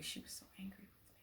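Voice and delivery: whispered aside. Real, not acted. Telling viewers how mad teacher was, in a whisper. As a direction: hush it like a secret. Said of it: "whispered aside".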